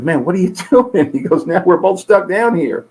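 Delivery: as if he can't believe his buddy jumped in; incredulous firefighter. Retold or imitated.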